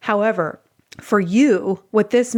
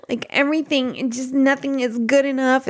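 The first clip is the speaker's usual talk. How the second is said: higher, pouty fussing; fussy baby; 5 semitones higher than usual. Acted. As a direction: whine it through pushed-out lips like a fussy baby. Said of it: "I would put my lips up".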